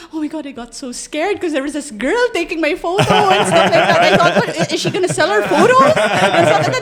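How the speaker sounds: bride's story. Retold or imitated. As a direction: lift the voice for her. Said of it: "she was telling us na".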